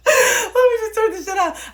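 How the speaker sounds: laughing through the words, high and loud. Real, not acted. Laughing at herself while shutting off the music. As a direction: crack up as you say it.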